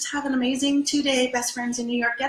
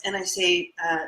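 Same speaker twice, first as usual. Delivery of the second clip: normal talking voice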